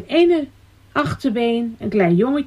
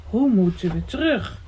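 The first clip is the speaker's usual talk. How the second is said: unworried echo; 3 dB quieter than usual. A narrator's reading. The boy ponders Elsje's question on the stone, unworried musing. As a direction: echo the question without any alarm.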